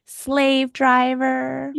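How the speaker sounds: very slow, loud "slave driver"; slow emphatic "slave driver"; drawn-out label. Shown as a demonstration. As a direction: stretch out "slave driver" slowly and loudly. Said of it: "I'm gonna excuse my language".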